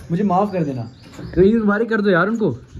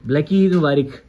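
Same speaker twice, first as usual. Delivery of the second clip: ordinary delivery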